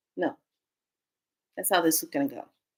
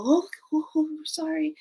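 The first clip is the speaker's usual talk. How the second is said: apologetic ghosts; 9 semitones higher, 3 dB quieter than usual. Retold or imitated. Ghost couple apologizing for disturbing her.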